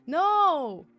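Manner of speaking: sudden high yelp of surprise; startled shout; surprised yelp. Real, not acted. Water drop hits face.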